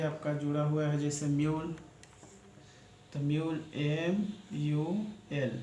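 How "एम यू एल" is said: letters drawn out very slowly; slow spelling. Shown as a demonstration. Teacher spelling mule letter by letter, very slowly. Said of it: "teacher spells word slowly".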